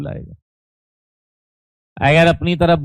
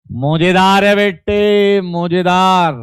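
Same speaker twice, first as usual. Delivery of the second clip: higher, slow, stretched-out exclamation; drawn-out praise; 4 semitones higher, 3 dB louder, slower than usual. Real, not acted. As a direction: draw out each word in delighted approval. Teacher cheers on a student's answer.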